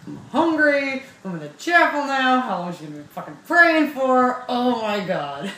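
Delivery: hungry daughter fuming in the chapel; hangry rant. Retold or imitated. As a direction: vent it like a starving kid. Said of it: "I'm so mad".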